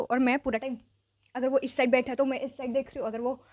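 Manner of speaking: ordinary talk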